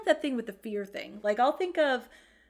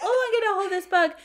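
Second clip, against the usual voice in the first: put-on high, loud eager kid voice with a laugh; voicing her little daughter; 11 semitones higher, 8 dB louder, faster than usual. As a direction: play the thrilled little girl. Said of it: "my daughter's like".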